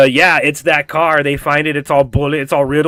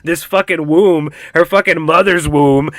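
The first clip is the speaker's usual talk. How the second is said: usual speaking voice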